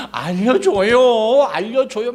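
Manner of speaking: high, slow wheedling plea; wife pleading. Retold or imitated. Wife nagging Samson for the answer, slow high wheedling. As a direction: drag it out, begging sweetly. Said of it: "막 자꾸 조른 거야".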